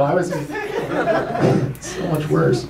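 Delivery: usual speaking voice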